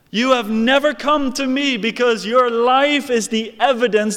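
high, loud pulpit denunciation; thundering condemnation. Acted. God judging those who still walk in sin, thundering condemnation. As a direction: hurl each accusation as a verdict. Voicing God's judgment at full force.

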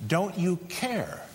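"Don't you care?" slow and high, "care" drawn out and falling; stress on care. Shown as a demonstration. Preacher showing the disciples' real question, slow plea on "care". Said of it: "That's their question".

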